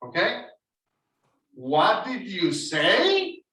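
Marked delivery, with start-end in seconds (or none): 1.5-3.4 slow "What did you say?" rising at the end; teacher modelling question intonation. Shown as a demonstration. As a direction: read "What did you say?" slowly with a clear question lift. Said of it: "You have to have a tone".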